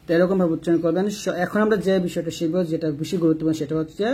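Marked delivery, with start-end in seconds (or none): none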